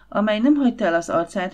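usual speaking voice

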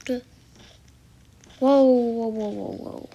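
ordinary talk, nothing marked

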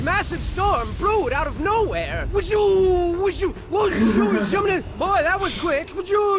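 loud wind and thunder effects; shouted storm noises. Acted. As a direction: belt out the storm like a cartoon. Storm sound effects narrated.

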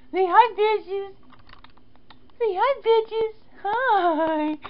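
high squeaky pet voice; cat voice. Pretend. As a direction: speak for the kitty, high.

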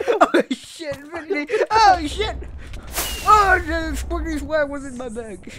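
high giggling exclamation; laughing squeal. Real, not acted.

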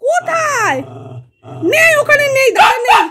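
high, sing-song talk to a pet. Real, not acted. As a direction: coax the pet in a high playful voice. Calling pets playfully. (উঁচু গলায় পোষ্যের সঙ্গে আদুরে কথা)

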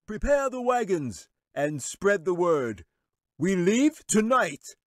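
high, slow commanding wizard voice; wizard's shout. Acted. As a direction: call out orders to the whole chamber. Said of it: "the wizard said with a shout".

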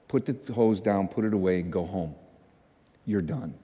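low, soft, quiet command; the Lord/character. Retold or imitated. The Lord tells the bus washer to stop, low hushed voice. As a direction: give a gentle, hushed instruction from above. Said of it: "I hear the Lord go".

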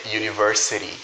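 university with the T tapped, univer-siri-ty; American flap T. Shown as a demonstration. Teacher models the flapped T for learners, clearly. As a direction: tap the T in university. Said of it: "esse T ele vai ter som de R".

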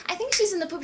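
lightly voiced little kid; retold innocent kid. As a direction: answer innocently like a small child. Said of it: "And I'm like".

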